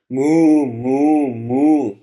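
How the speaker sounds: loud, drawn-out cow moos; mooing. A narrator's reading. Woman mooing as she relives the wounded cow, loud mooing. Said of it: "the woman moos".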